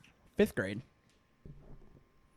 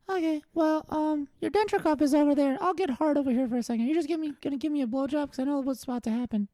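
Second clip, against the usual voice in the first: put-on little boy voice; 5 semitones higher, faster than usual. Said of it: "little Joey".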